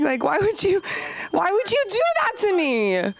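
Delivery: mock whining. Real, not acted. Comic pleading with Doctor Goldenberg, high and whiny. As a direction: whine like a wronged patient.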